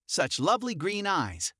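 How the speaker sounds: plain reading voice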